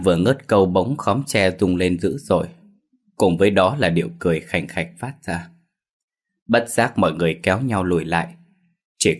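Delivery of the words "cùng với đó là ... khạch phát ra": ordinary delivery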